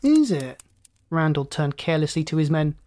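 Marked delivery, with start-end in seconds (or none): 0.0-0.6 high-starting, falling query; airy, sceptical challenge; mocking question. A narrator's reading. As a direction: feign surprise to taunt him. Randall's mocking reply.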